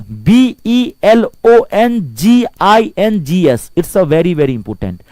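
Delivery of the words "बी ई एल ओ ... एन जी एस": slow, letter by letter spelling. Shown as a demonstration. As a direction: dictate each letter slowly to the class. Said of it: "teacher spelling word aloud".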